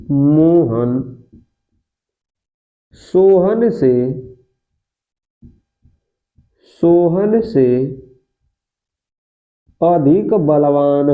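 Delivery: word by word with long pauses, slowly; slow dictation. Shown as a demonstration. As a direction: dictate it so students can write it down. Teacher dictating example sentence slowly.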